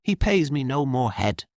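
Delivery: lightly voiced young woman, dry and wry; a hint of a bitter maid, understated. The girl tells Jorian how her master treats her. Girl's voice in dialogue.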